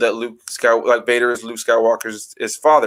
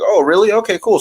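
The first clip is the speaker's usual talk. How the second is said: mock surprised friend; 8 semitones higher, 5 dB louder than usual. Friends learn it's the worst.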